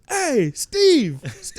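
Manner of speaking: high, loud imitated holler; Austin Carr yelling. Austin calling the author across the hotel lobby, hollering. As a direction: hail someone way across the room. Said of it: "he just yells out".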